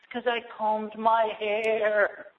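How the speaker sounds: slow, tearful put-on woman's voice; slow, hammed-up crying complaint; mock crying woman. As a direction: whimper it out like a distressed pilgrim. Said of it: "She's crying".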